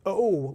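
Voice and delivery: "O" held as a long, drawn-out vowel. Shown as a demonstration. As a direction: stretch the "O" right out. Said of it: "a really long vowel sound".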